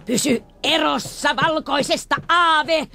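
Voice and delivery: high, loud slow yell after her; old man shouting after the fleeing girl; shouted warning. Acted. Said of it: "mies huusi hänen peräänsä".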